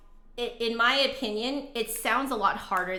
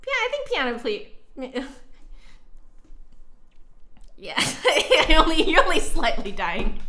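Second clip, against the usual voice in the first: laughing; 7 semitones higher, 5 dB louder than usual. Real, not acted. Laughing at being quoted.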